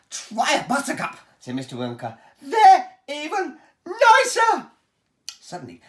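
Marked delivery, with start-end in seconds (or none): 0.1-1.2 high, loud showman boasting; a proud host showing off his pipes; Willy Wonka voice. Acted. Wonka booms to the children about his pipes, showman voice. 2.4-4.7 high, loud showman boasting; a proud host showing off his pipes; Willy Wonka voice. Acted. Wonka booms to the children about his pipes, showman voice.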